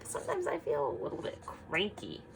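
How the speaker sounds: usual speaking voice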